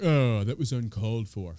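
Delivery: mock the pearl-clutching Republicans; whiny Republicans. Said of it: "Republicans, like".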